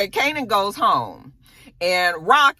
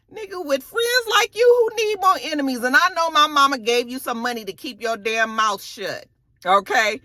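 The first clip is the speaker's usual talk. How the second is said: mock angry Kanan; 7 semitones higher than usual. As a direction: play him outraged at a friend. Kanan confronts Fame over the gun.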